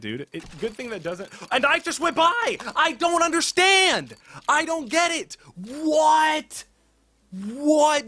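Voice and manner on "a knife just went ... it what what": shocked outburst. Real, not acted. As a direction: yell it like you can't believe your eyes. A second knife went by.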